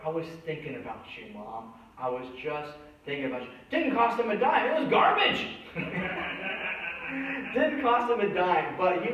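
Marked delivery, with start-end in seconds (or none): 0.0-3.5 softer, tender; tender son. Retold or imitated. Son tells mom tenderly. 4.8-7.8 laughing. Real, not acted. Laughing punchline.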